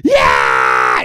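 loud drawn-out crowd yell; crowd shout. Retold or imitated. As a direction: shout it back like a whole audience. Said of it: "everyone will go".

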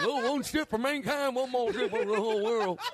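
high, goofy moron voice. Retold or imitated. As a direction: recite grandly as a high-voiced simpleton. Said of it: "make me sound like a moron".

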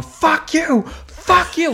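high-pitched mock yell; imitated heckler's yell. Kid giving the comic the finger, imitated yell. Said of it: "like, yelling at me".

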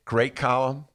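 a hint of Mark Brown's voice; lightly voiced columnist praise; imitating Mark Brown. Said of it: "I know that's a terrible imitation".

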